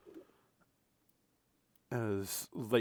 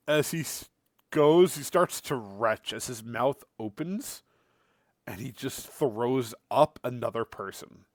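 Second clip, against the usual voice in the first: ordinary talk, nothing marked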